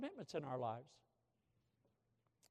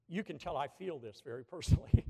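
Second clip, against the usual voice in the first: ordinary delivery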